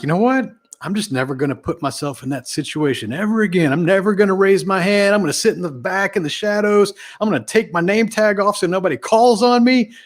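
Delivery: high, mock-timid vow to hide; squeaky pretend dread of being called on; imitating a scared newcomer. A scared conference attendee swearing never to speak again, mock-timid and high. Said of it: "most people were like".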